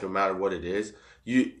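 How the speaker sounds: ordinary talk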